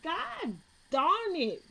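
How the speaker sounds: frustrated groan. Retold or imitated. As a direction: stretch it out in defeat. Giving up in frustration.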